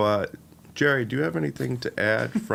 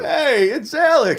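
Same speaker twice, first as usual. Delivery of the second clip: high, loud, drawn-out cheer; excited greeting; 11 semitones higher, 5 dB louder, slower than usual. Real, not acted. Host announcing Alex's arrival, high excited shout. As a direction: announce a friend with delight, slowly. Greeting Alex appearing in the room.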